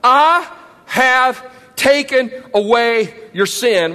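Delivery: slow and weighty, each word landing; a preacher dwelling on the promise of forgiveness; slow emphatic reading. Shown as a demonstration. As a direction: read it slowly so it sinks in. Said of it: "Underline that".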